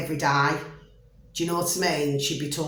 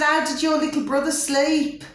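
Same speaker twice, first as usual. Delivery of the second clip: put-on friendly question to a boy; 9 semitones higher than usual. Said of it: "I said, I said".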